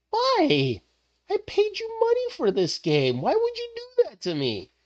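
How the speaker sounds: mock whine. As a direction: whine like a betrayed customer. Whining at the game.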